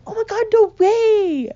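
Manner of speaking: high, loud, drawn-out gasping excitement; mock childish amazement. Little kid reacting to sister hearing Santa, hammed-up amazement. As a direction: play an over-thrilled kid, stretched and loud.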